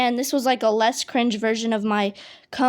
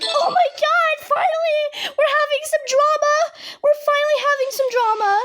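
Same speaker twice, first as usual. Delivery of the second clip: very high, loud, mocking squeal with a laugh; gleeful gossip voice; 3 dB louder than usual. Clinic staff cheering the fainting drama, high gleeful mocking squeal. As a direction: mimic the thrilled staff, shrieking with delight.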